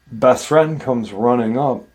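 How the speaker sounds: usual speaking voice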